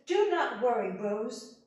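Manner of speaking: high, loud, soothing promise; father reassuring his daughter Rose. Acted. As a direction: comfort her firmly. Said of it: "and he says".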